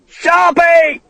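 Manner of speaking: high, desperate call of a name; shout. A narrator's reading. Man among snakes to departing enemy, high shout. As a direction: yell up to someone far above. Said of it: "he shouted".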